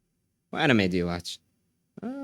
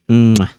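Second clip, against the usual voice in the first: loud kiss; 14 dB louder than usual. Acted. Blowing a kiss to chat.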